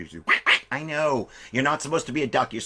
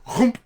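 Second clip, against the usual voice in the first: loud, high gulping chomp; cartoon bear chomp; 11 semitones higher, 7 dB louder than usual. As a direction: gobble it in one bite. Bear swallowing the boy.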